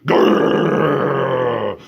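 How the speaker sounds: mock growl. Dog growling at the burglar, a mock growl. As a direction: growl to look fierce. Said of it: "She growled".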